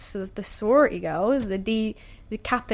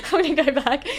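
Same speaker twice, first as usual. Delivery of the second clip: higher and brighter, defiant resolve; mock determined; 5 semitones higher, 4 dB louder than usual. Repeating her decision to the host, hammed-up and resolute. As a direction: say it like a rallying cry to yourself. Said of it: "Okay, I'm gonna go back".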